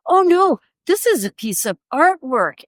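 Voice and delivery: mock-affronted correction starting high; indignant insistence. Artist retelling her second protest to the doorman, indignant.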